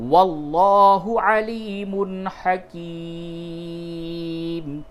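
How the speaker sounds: slow, high chanted tajweed recitation; Quran recitation. Shown as a demonstration. Teacher chanting the verse for students, slow and melodic. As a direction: intone the verse slowly and melodically.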